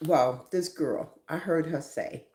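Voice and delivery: ordinary talk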